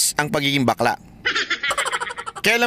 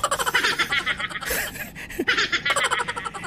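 high, breathy burst of laughter; real laugh at the jab; 6 dB quieter than usual. Real, not acted.

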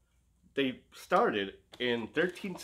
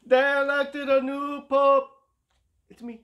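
pope proclamation; 13 semitones higher, 9 dB louder than usual. Pretend. Playing the Pope, announcing.

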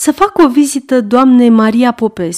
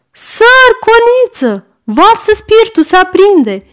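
put-on high, loud old woman calling from next room; old servant woman; 11 semitones higher, 3 dB louder than usual. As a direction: cry out like a fussing old maid. Old servant raises alarm.

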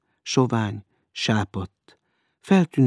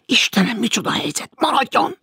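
high-pitched cross outburst; fume, then cry out the order; exasperated anger; 12 semitones higher than usual. A narrator's reading. Maud orders the stranger to stay, exasperated. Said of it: "és dühösen toppantott".

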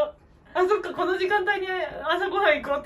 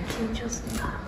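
quiet; 7 semitones lower, 4 dB quieter than usual. Real, not acted. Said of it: "そっと向かいたいと思います".

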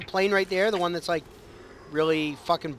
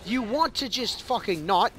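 usual speaking voice

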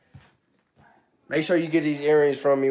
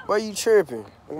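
ordinary talk

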